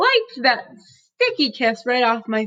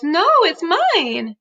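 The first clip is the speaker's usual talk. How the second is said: put-on whiny toddler; 6 semitones higher, 4 dB louder, much slower than usual. Toddler Fudge refuses, clinging to bike.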